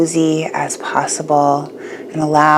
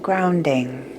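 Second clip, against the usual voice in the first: guided meditation; 6 dB quieter than usual. Acted.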